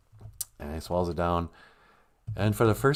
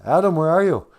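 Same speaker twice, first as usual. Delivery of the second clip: louder, higher booming call; God calling out; 6 semitones higher, 5 dB louder than usual. Acted. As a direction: call down from heaven to Adam. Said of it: "God says".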